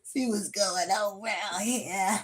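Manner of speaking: slow, drawn-out, rising at the end; slow sing-song. Shown as a demonstration. Reader stretches the line to viewers, slow and teasing. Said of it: "repeated, slower take".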